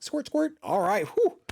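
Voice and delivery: high squeaky relieved dog; lightly voiced little dog sighing with relief; mock dog voice.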